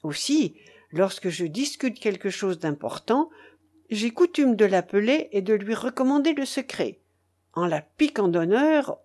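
ordinary delivery, nothing marked

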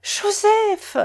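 high-pitched joyful call; high, exultant exclamation; excited cry. A narrator's reading. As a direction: cry the beloved name from afar. Mother calls out the awaited name.

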